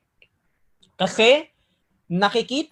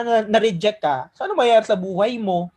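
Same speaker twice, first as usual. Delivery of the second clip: ordinary delivery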